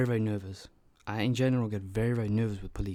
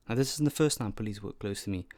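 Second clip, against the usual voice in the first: normal talking voice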